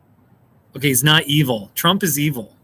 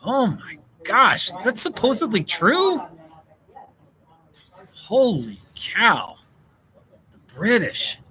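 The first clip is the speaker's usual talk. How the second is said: amazed; 3 semitones higher, slower than usual. Real, not acted. Amazed at the UK law.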